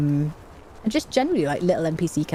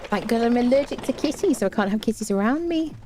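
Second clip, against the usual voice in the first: higher-pitched mock Brummie accent, rising; 7 semitones higher than usual. Streamer voicing Doom on kitty allergy, Brummie accent. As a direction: play a Brummie character, pitched up.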